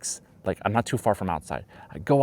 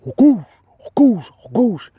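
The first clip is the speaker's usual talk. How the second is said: loud high effortful heaves; straining grunts; 12 dB louder than usual. Retold or imitated. Teacher doing Heimlich maneuver, loud high straining grunts. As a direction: push out each word like a Heimlich thrust.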